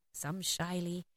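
very soft, shrinking delivery; small, bashful narration; shy. A narrator's reading. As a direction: say it timidly, barely projected. Narrator acts out the word shyly.

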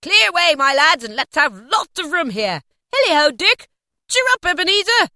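high, loud, rollicking orders; rally the lads with great gusto; jovial cry. A narrator's reading. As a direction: exuberant old master hollering. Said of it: "cried old Fezziwig".

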